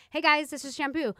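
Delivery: casual chatty video greeting; natural, friendly. Retold or imitated. Imitating her later videos to viewers, natural and friendly. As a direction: greet them like a girlfriend, unforced. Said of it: "pretending like I was talking to a friend".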